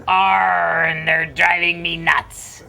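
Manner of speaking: growled joke-pirate punchline. Acted. Pirate answers the bartender's question, hammy pirate growl. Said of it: "Pirate walks into the bar".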